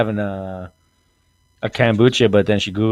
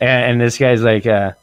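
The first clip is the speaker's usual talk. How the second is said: normal talking voice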